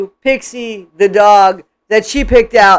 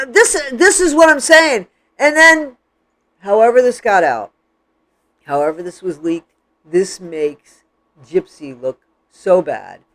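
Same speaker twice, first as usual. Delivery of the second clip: ordinary talk, nothing marked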